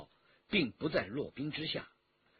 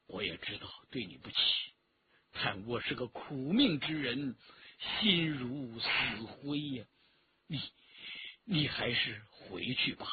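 low, soft, resigned regret; sorrowful apology; 5 semitones lower, 4 dB quieter than usual. A narrator's reading. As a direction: turn the beloved away sadly, voice dropped low. Man sadly refuses the crying girl. (低声愧疚，黯然劝离)

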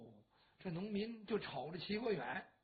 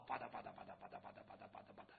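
rapid, hushed tongue-clicks for hooves; clopping hoofbeats; 11 semitones lower, 16 dB quieter, much faster than usual. A narrator's reading. Narrator imitating the horse's hooves on the road, quick soft clopping. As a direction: patter out the horse's hooves under the breath.

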